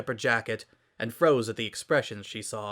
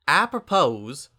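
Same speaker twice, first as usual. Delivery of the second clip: country drawl, raised pitch and volume; Applejack voice; 4 semitones higher, 6 dB louder, slower than usual. A narrator's reading. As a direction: voice the farm girl with a drawl. Applejack character voice.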